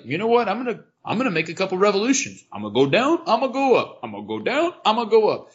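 playful excited announcement. Pretend. Acting out wish to ride escalators.

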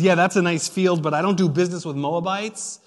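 a TV investor brushing off a pitch, loud and dismissive; dismissive shark. Acted. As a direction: wave her off loudly like a TV investor. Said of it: "And the next shark says".

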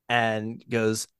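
ordinary delivery

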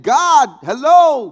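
exasperated call. Retold or imitated.

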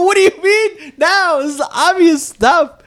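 slow, high-pitched disbelief; exasperated. Retold or imitated. Son telling mom it was obvious, exasperated. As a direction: stretch it out, high and incredulous.

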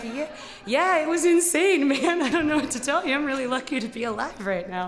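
high, loud, laughing babble; shaky laughing relief. Retold or imitated. To the giant trucker, high laughing relief. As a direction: gush with nervous relief. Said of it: "And I was like".

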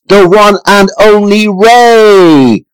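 slow, grand ring-announcer build-up. Acted. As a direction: stretch it out like a boxing MC. Grand announcer intro of guest.

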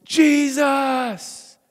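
high, loud shout; crowd's rallying shout; shouted answer. Retold or imitated. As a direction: yell the name back in unison. Crowd answers call-and-response.

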